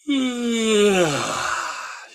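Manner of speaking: big high loud yawn sliding down; real yawn. Real, not acted. Coach yawning for real while teaching, loud and long. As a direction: caught by a genuine yawn. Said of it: "you'll find yourself yawning".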